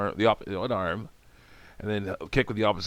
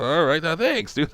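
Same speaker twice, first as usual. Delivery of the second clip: mock cheerful thanks; 8 semitones higher, 6 dB louder than usual. Thanking after the correction.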